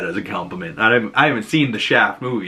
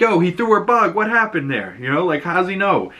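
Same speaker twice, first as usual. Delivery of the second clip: surprised; 7 semitones higher than usual. Retold or imitated. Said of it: "And they're like".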